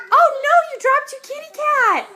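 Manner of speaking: put-on high, loud baby-talk alarm; mock dismay. A parent mock alarmed at the toddler dropping the kitty. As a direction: act shocked for the little one.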